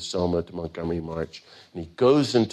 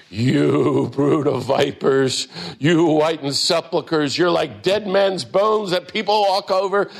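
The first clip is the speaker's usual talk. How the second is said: higher, louder, slow thundering rebuke; retold yelling rebuke; 7 semitones higher, 5 dB louder, slower than usual. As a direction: hurl the insults at the authorities. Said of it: "he's yelling and cussing at him".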